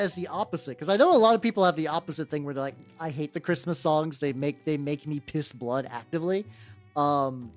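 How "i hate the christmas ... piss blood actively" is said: ordinary delivery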